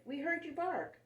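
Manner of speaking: put-on high, excited child voices; children crying out. A narrator's reading. Children finding their lost dog, high and excited. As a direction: call out in relief. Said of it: "they cried".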